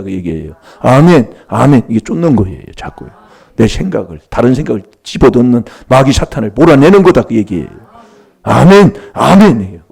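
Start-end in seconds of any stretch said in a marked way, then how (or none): 0.8-1.9 loud amen. Shown as a demonstration. Preacher shouting amen to the congregation, loud. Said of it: "그래서 아멘 하라". 8.4-9.8 loud amen. Shown as a demonstration. Preacher shouting amen to the congregation, loud. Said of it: "쓰러질 정도로 그렇게 한번 해보란".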